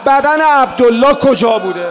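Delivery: loud rising lament, crying out the questions; impassioned mourning sermon; preaching at full force. Acted. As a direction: build to an anguished public outcry.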